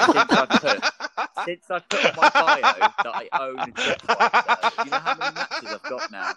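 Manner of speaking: high, loud laughing boast. Real, not acted. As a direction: laugh through the words. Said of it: "laughing through boast".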